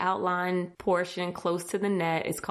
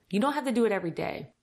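ordinary talk, nothing marked

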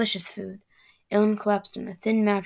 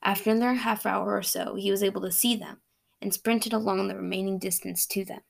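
ordinary delivery, nothing marked

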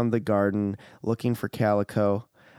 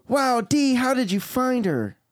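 put-on very high little girl voice; amazed squeaky toddler; young Princess Leia; 4 dB louder than usual. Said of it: "sounds like young Princess Leia".

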